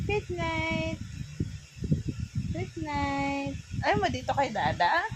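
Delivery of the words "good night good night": soft good night. Real, not acted. Soft bedtime goodnight.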